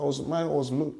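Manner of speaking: ordinary talk, nothing marked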